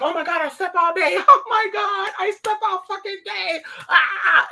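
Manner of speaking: high, loud panicked yelp with laughter; panicked laughing shout. Retold or imitated. Waking from a nap thinking the day is gone, laughing panicked shout. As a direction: freak out laughing about sleeping all day. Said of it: "and I'm like".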